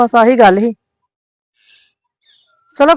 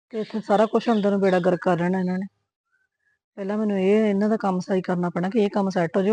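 low, soft musing under her breath; wife worrying to herself after the phone call, low and soft; muttering to herself; 4 semitones lower, 8 dB quieter than usual. Acted. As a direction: think aloud quietly, lower and softer.

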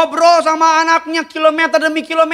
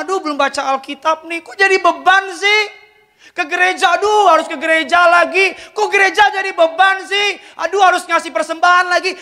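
whiny complaint; 6 semitones higher than usual. Retold or imitated. Preacher mimics reluctant churchgoer.

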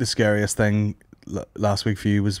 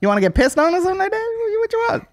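much higher, fast sleazy pimp voice; old pimp voice; faster than usual. Retold or imitated. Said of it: "that's a good voice. Old pimp".

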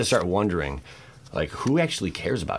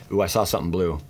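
ordinary talk

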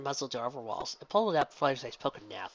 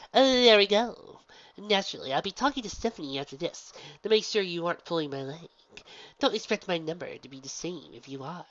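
showy gym leader; 5 semitones higher, 5 dB louder than usual. Acted. Gym leader explains her stage act to Pinky, loud and showy. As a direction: ham it up for the crowd.

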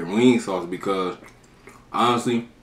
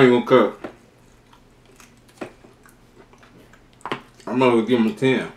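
chewing while talking; biting into chicken wing; mouth full of chicken; 3 semitones higher, 6 dB louder than usual. Real, not acted. Seen 1: Wing at lips 0.2–2.2s, then talks with mouth still working.

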